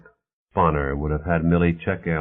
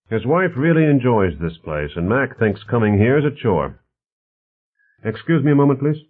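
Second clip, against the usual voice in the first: lightly voiced host, higher in pitch; lively, mildly voiced character dialogue; affable host Bonner; 6 semitones higher, 5 dB louder than usual. Bonner talks politics with his guests, lightly voiced affable host.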